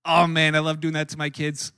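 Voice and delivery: ordinary talk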